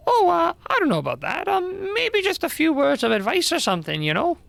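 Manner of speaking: high-pitched, hesitant backpedalling; hedging slangy busybody. Acted. Busybody doubts the old dragon can fight, high and hedging. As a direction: back off awkwardly in a high voice.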